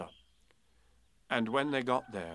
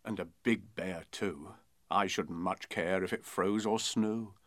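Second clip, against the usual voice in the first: put-on steady, rhythmic children's rhyme; poem recital; 4 dB louder than usual. A narrator's reading. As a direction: read it like a bedtime verse.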